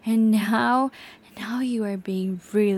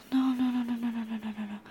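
fading no; 6 dB quieter, much faster than usual. Acted. Regret after hitting him.

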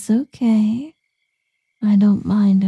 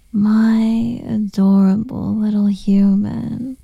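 normal talking voice

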